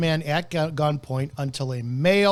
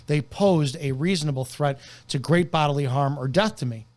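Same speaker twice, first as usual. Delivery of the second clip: ordinary talk, nothing marked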